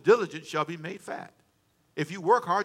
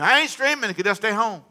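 high, loud mock protest; indignant refusal; 5 semitones higher, 7 dB louder than usual. Imitated pastor objecting to streaming, indignant. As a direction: imitate a stubborn pastor balking.

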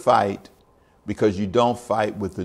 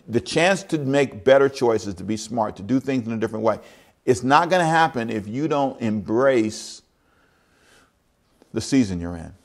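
ordinary delivery

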